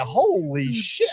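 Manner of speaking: high, slow, drawn-out awe; retold amazement. Racer recalling a rival's time in the lanes, drawn-out retold amazement. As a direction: stretch it out as a replayed stunned reaction. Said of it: "I was like".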